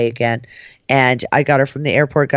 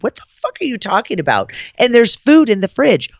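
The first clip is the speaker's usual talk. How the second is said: higher-pitched indignant protest; retold indignation; 5 semitones higher than usual. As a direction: protest your innocence, incredulous. Retold quarrel with director.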